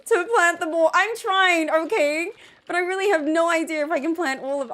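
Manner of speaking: loud, high, panicked pleading; rushing to plant before passing out; freaking out. Real, not acted. Player panicking at the late hour, loud and high. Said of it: "I'm freaking out".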